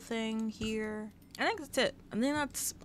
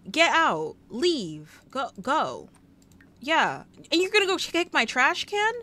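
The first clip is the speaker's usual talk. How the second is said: loud and higher, indignant; mock furious rant; 4 semitones higher, 5 dB louder than usual. Throwing out the man who disrespected her dog, loud and indignant. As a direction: chase him out of the house.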